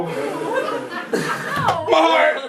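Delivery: melodramatic fake heart attack. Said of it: "to fake a heart attack".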